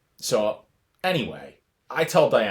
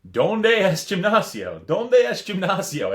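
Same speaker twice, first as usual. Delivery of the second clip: slow, much higher, louder halting Spanish; clueless tourist Spanish; 10 semitones higher, 6 dB louder, slower than usual. Retold or imitated. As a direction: sound out each word like a lost tourist. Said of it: "I'm walking around saying".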